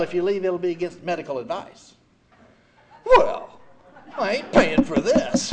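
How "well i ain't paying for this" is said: a much higher, louder outburst on Well, then slow and defiant; furious refusal. Retold or imitated. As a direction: blurt it out at the nurse, indignant. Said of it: "Oh, I was furious".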